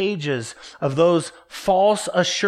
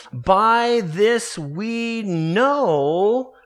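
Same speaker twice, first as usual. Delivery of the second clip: "By this we know" dragged out very slowly, rising; slow emphatic reading; 3 semitones higher, much slower than usual. Shown as a demonstration. Preacher stressing the tense of the verse, very slow. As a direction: stretch every word of "By this we know". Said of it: "present tense, ongoing action".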